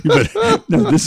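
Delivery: high laughing burst. Real, not acted. Laughing at the impression.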